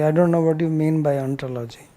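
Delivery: ordinary talk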